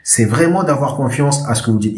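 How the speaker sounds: ordinary talk, nothing marked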